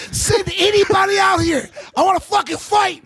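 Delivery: high wrestler-style challenge; livid. Acted. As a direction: call out for another opponent in a fury. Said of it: "like it was wrestling".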